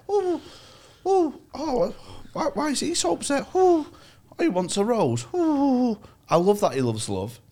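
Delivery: high, gushing bird voice with a laugh; swooning nightingale. Pretend. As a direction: coo over him like a lovesick bird. Nightingale voiced in retold story.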